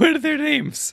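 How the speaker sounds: high-pitched comic New York voice; mock New York accent. Friend asks after Dickens's children, mock New York accent. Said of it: "do it in the New York accent".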